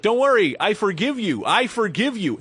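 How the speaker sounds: high, loud, sweetly smug pardon; mock saintly forgiveness. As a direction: call out forgiveness to a driver who nearly hit him. Mock forgiving the angry driver.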